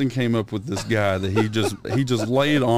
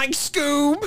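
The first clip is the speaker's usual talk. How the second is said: much higher Shaggy call. Pretend. Asked to do Shaggy, high call. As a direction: squeak Scoob like Shaggy. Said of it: "The only thing I can do is".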